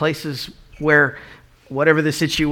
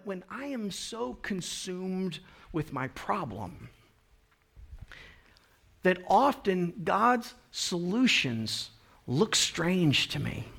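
reflective quiet; 7 dB quieter, slower than usual. Real, not acted. Reflective softened preaching.

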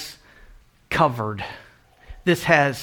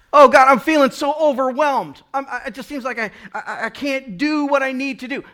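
higher, louder overwhelmed complaint; a believer complaining to God, high and loud; overwhelmed whine; 8 semitones higher, 6 dB louder than usual. Retold or imitated. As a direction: moan to God you can't cope.

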